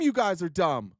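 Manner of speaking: ordinary delivery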